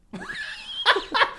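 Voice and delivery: high-pitched helpless cackle; high laugh. Real, not acted. Friend cracking up at coffin joke, high helpless laugh. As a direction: burst into squealing laughter.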